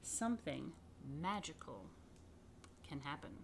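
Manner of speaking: storybook reading, fading to hushed wonder; reading to children. Acted. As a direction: read it like a bedtime tale. Reading picture book to children.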